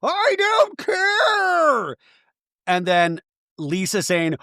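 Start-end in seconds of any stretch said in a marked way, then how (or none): 0.0-2.0 very high, very slow crying yell; hammed-up tearful outburst; wailing Meredith impression. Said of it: "You have Meredith at a ten".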